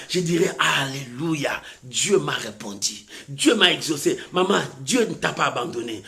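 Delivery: low, soft sermon voice; subdued, reverent proclamation; hushed testimony preaching. Real, not acted. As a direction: give thanks quietly, gradually gaining strength. Softly preached testimony of thanks.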